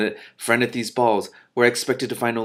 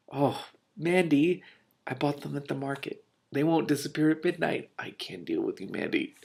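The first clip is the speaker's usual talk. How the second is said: soft touched reaction; 5 semitones higher, 4 dB quieter than usual. Real, not acted. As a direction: let it melt out tenderly. Reader moved by Mandy.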